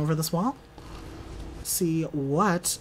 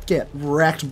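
ordinary talk

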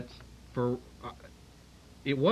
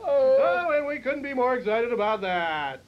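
announcer voice; 5 semitones higher, 3 dB louder than usual. Acted. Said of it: "I'm not gonna do this announcer voice".

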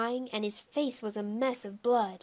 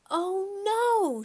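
high, drawn-out cry of alarm; high-pitched mock scream; 11 semitones higher, 4 dB louder than usual. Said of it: "Shyla screamed".

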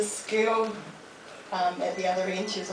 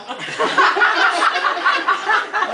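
laugh; 10 semitones higher, 6 dB louder than usual. Real, not acted. Laugh after punchline.

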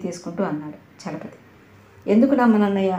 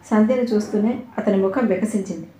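plain reading voice

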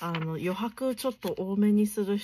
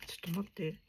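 ordinary delivery

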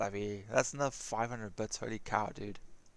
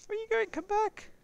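high-pitched call. Real, not acted. Calling after fleeing character.

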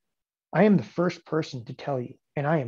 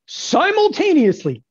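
high, loud, stretched-out word; theatrical announcement; 13 semitones higher, 8 dB louder, slower than usual. Acted. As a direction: belt it out slowly like a big reveal.

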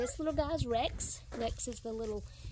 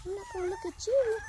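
fast, higher mock puppy voice; voicing the puppy; 8 semitones higher, faster than usual. Owner voicing the puppy refusing to look, fast and higher. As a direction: speak for the stubborn pup, quick and higher. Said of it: "He's like".

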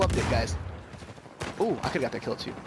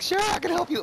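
imitating a high pubescent voice crack; 12 semitones higher than usual. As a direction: crack up high mid-greeting, re-enacting it. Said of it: "My voice cracked, like, super bad".

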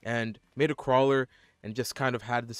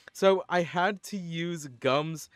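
ordinary talk, nothing marked

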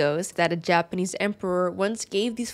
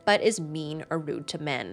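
usual speaking voice

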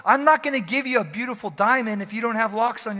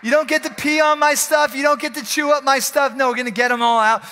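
1 scolding; 8 semitones higher, 6 dB louder than usual. Acted. Scolding demons like pets.

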